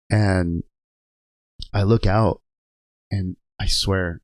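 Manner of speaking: normal talking voice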